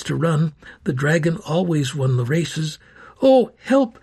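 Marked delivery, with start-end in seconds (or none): 3.2-4.0 high, loud desperate cry; crying for help. A narrator's reading. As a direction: call out in panic. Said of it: "she cried".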